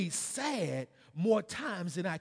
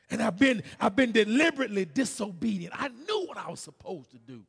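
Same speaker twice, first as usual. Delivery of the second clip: loud, raised preacher's confession; forceful preaching; 5 semitones higher, 9 dB louder than usual. Acted. Preacher confessing his disobedience to the church, forceful loud preaching. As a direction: testify with driving force.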